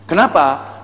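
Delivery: ordinary delivery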